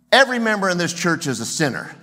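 loud, fast pulpit thunder; thundering preacher. Retold or imitated. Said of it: "He thunders on a Sunday morning".

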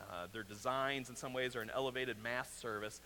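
usual speaking voice

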